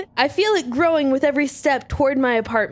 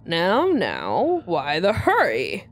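low Southern drawl, much lower; lower, drawling Southern bad guy; Southern villain; 8 semitones lower, slower than usual. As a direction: lean in with a lazy Southern menace. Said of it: "I don't know why I'm making him Southern".

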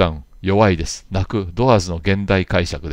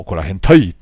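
怒ら変態 said out loud in a Kansai-meets-Kyushu dialect, louder; louder, playful dialect take on 怒ら変態; mixed dialect delivery; 10 dB louder, slower than usual. Shown as a demonstration. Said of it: "なんて言うとね、言葉で言うと".

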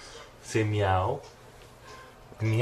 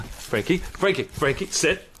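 normal talking voice

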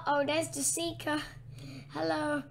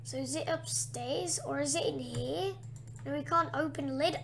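usual speaking voice

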